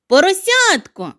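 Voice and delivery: high loud call; shout. Acted. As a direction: shout the name through the window. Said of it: "крикнула сніжинка".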